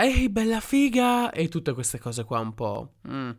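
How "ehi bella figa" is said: catcall shout. Retold or imitated. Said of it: "urlare da una parte all'altra della strada".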